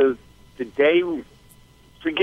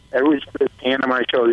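ordinary talk, nothing marked

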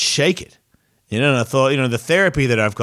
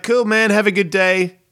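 retold casual send-off, pitched up; lightly acted friendly brush-off in a higher voice; breezy goodbye; 8 semitones higher than usual.

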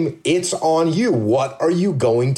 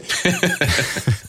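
short laugh. Real, not acted. Host laughs at ego remark.

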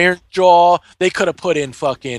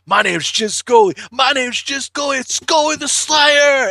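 boasting barbarian; 7 semitones higher than usual. Retold or imitated. Scully announces himself, rising hammed-up boast. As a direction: puff up and announce yourself grandly. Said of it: "He's like".

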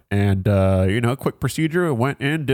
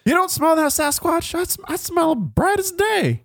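goofy high voice. Pretend. Joking as a sniffing bloodhound.

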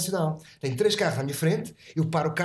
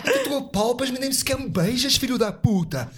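mock insult; 8 semitones higher than usual. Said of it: "tiro e digo".

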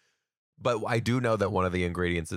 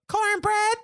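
high-pitched, loud mimicked kid voice; mimicking a tiny Southern niece, very high; Southern little girl; 5 dB louder than usual. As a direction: squeal it like an excited Southern kindergartner. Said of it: "a little Southern".

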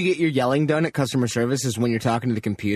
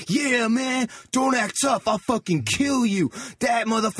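put-on aggressive drunk; 6 semitones higher, slower than usual. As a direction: bark it like an angry drunk. Retold aggressive drunk at club.

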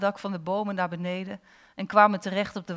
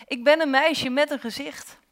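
higher, excited exclamation; jubilant cry; 6 semitones higher, 4 dB louder than usual. A narrator's reading. Girl leaping into the water, crying out joyfully. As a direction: cry it out in delight, a little louder. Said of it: "sprong ze luid gillend in het water".

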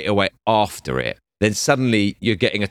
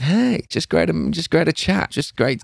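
playing it cool; 8 semitones higher than usual. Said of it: "So I just tried to be cool".

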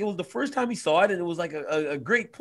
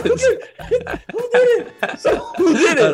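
high, loud, laughing question; 4 dB louder, slower than usual. Real, not acted. After learning they both got the same box, he asks laughing.

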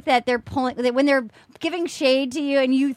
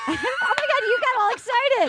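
high laughing exclamation; breaking up laughing, pitched high; 9 semitones higher than usual. Real, not acted. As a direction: tease through helpless laughter. Teasing co-host amid laughter.

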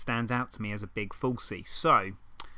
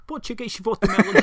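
a hammed-up Portuguese accent, higher and louder; mock Portuguese accent; 9 semitones higher, 6 dB louder than usual. Mocking how Portuguese sounds, in a hammed-up Portuguese accent. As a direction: ham up a fake Portuguese lilt, sh for s. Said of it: "Portuguese is more like".

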